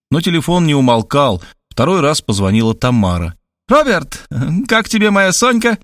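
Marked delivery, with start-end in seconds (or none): 3.7-5.8 high, teasing woman; playful Tamara. A narrator's reading. Tamara on the phone teasing Robert about Sonka, playfully. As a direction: tease him playfully. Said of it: "игриво спросила она".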